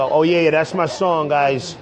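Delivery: ordinary talk, nothing marked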